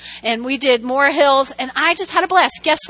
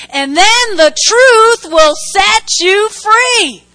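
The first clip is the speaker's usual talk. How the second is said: slow, high, loud preaching climax; real preacher's shout, every word drawn out; triumphant proclamation; 6 semitones higher, 6 dB louder, much slower than usual. Real, not acted. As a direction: proclaim it to the whole room, stretched out.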